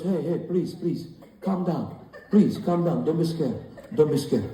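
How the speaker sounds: put-on soothing Italian-accented mafia lover; calm her gently in an Italian accent; smooth Italian accent. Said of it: "What kind of Italian accent is that?".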